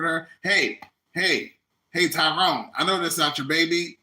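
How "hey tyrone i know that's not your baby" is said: ordinary delivery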